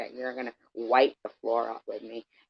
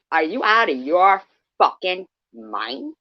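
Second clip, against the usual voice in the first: angry outburst; 5 semitones higher, 6 dB louder than usual. Real, not acted. Confronting a chatter.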